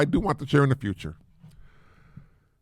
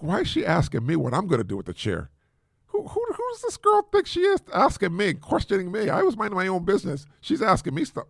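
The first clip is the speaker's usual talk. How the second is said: higher-pitched mock indignation; retold indignation; 5 semitones higher than usual. Dad privately fuming at daughter questioning him, retold indignation. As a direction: grumble to yourself like an affronted parent. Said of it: "now I'm getting fumed here".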